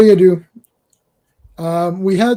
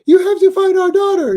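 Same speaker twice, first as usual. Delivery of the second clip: very high, held-pitch cartoon wife; 13 semitones higher, 5 dB louder than usual. Host as cartoon wife demanding the daughter back, high mock plea. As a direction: wail it on one high note, mock-pleading. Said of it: "it's like [name] is the wife".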